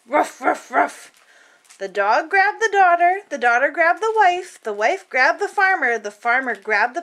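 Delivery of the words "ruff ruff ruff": mock bark, spoken; dog barking. Dog answering the call, mock barks. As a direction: woof like a playful dog.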